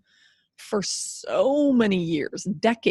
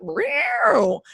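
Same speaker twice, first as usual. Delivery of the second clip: high, purring cat sound; a flirty little meow as a cougar joke; playful cougar meow; 6 semitones higher, 4 dB louder than usual. Acted. Host joking about her animal print shirt, playful high meow. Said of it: "I could make a cougar joke here".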